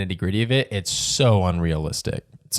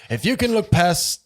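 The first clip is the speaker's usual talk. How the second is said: put-on old storytelling voice; 9 semitones higher than usual. Shown as a demonstration. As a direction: keep the storyteller voice going. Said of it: "my old storytelling voice".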